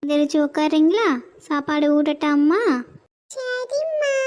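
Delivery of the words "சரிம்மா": put-on much higher, slow whiny child voice; teary little kid begging mom to stay. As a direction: plead like a small sick child afraid of injections. Child agrees.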